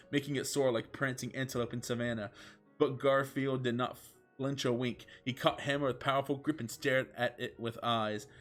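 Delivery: usual speaking voice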